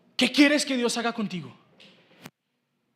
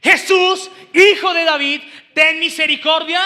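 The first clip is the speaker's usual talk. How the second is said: scripture cry read in a raised voice; 4 semitones higher, 8 dB louder than usual. A narrator's reading. Preacher reading the blind man calling to Jesus, crying out. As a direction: read it as a loud plea. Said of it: "comenzó a dar voces y a decir".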